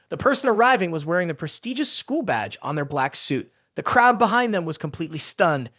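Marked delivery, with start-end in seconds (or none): none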